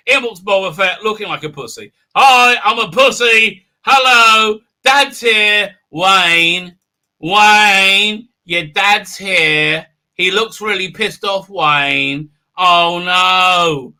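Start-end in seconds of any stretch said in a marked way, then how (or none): 2.2-13.9 loud, drawn-out teasing call; hammed-up mock announcement. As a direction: sing out the taunt, stretching the words. Mocking Boba Fett's entrance.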